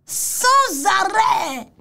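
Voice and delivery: Sans arrêt dragged out slowly and shouted; slow emphatic shout. Shown as a demonstration. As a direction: stretch out and yell Sans arrêt. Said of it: "il ment sans arrêt". (Sans arrêt crié très lentement)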